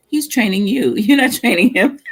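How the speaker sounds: teasing laugh breaking into the words; laughing while telling her. Retold or imitated. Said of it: "I told her".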